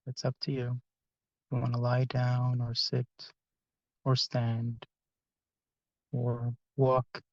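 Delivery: soft, unhurried meditation voice with long pauses; guided meditation. Acted. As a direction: gently guide the group into relaxation, phrase by phrase.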